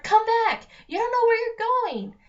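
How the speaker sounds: loud, high desperate shout; panicked shout; yelling. A narrator's reading. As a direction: yell after her as she runs. Connor yelling after fleeing sister.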